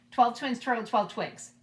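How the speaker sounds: ordinary talk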